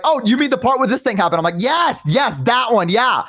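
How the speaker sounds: high, eager fake agreement; bluffing fan. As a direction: fake it through the chat. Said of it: "and I'd be like".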